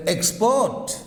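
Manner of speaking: "export" slowly, with a long, stressed second syllable; stress on last syllable. Shown as a demonstration. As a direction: stretch and punch the end of "export". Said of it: "on last syllable".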